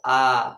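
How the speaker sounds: a loud, held आ with the mouth fully open. Shown as a demonstration. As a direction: open wide on आ to demonstrate the vowel. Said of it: "अब मैं आ बोल रहा हूँ". (पूरा मुंह खोलकर ज़ोर से आ)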